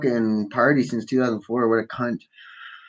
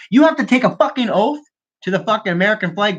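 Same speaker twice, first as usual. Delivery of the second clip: much higher, worked-up repeat; indignant insistence; 7 semitones higher than usual. Real, not acted. Ranting about a politician's dual citizenship, indignantly insistent. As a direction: drive the point home, pitch jumping up.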